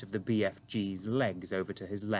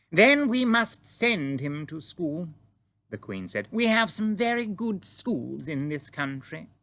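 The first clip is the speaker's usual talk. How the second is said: higher, slow, regal Queen voice; Queen greeting the giant calmly; composed Queen; 7 semitones higher, 4 dB louder than usual. A narrator's reading. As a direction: stay perfectly poised, royal.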